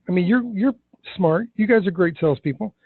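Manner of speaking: ordinary talk, nothing marked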